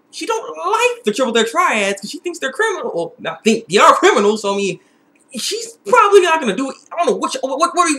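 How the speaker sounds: ordinary talk, nothing marked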